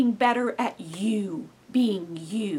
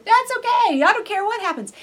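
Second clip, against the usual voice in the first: much higher and louder, boldly carefree; big sounding; 12 semitones higher, 7 dB louder than usual. Retold or imitated. David to his enemies, big and carefree. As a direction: announce it big and fearless. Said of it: "this big sounding".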